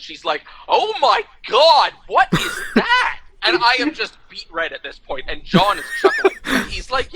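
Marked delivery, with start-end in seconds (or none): 0.7-1.9 loud, high, drawn-out astonishment; stretched-out, hammed-up shocked cry; mock shock. Retold actress reacts in shock. 2.1-3.2 high, slow, scandalized question; drawn-out high disbelief, hammed up; mock shock. Retold actress reacts in shock.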